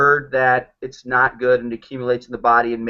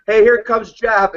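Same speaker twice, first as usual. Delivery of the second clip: mock staff greeting; 7 semitones higher, 4 dB louder, slower than usual. Dentist office staff greeting joke.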